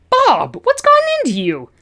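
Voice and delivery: very high squeaking voice; squeaky. Acted. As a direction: squeak it out, alarmed. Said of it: "you squeaked".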